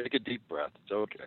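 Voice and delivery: soft, hushed reassurance; softly calming a worked-up friend; mock soothing. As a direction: talk him down quietly. Co-host soothes the exasperated host.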